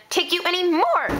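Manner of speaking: rising, exasperated cry; fed-up outburst. Acted. Girl telling off her lifelong bully, exasperated outburst. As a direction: let the frustration climb to breaking point.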